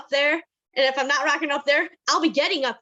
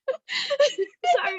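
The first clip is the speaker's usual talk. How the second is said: a real choked-up sorry, much higher; crying through the word; tearful apology; 13 semitones higher than usual. Real, not acted. [name] apologizing to the group while crying. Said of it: "I don't wanna cry".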